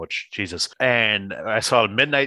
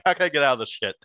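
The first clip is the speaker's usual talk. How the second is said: higher-pitched mock exasperation; teasing imitation; 7 semitones higher than usual. Teasing the co-host who hates cheering, mock desperation. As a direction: play up a co-host's desperate complaint. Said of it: "You're like".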